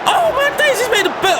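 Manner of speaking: squealing disbelief. Real, not acted. Reacting to penalty in disbelief.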